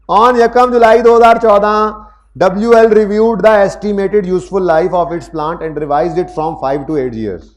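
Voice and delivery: slow, loud, deliberate reading of the question; reading aloud. Acted. Teacher reading the exam question aloud to students, slow and loud. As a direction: read each word out slowly for the class.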